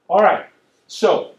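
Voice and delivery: loud, slow, drawn-out all right; loud call to attention. Real, not acted. Preacher calling the congregation back, loud and slow. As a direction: pull the room back to you.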